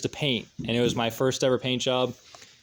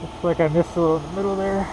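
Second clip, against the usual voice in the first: muffled behind a full face mask; talking through a mask; 3 semitones higher, 4 dB louder than usual. Real, not acted. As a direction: voice dulled by a painting mask. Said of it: "I'm talking through a mask".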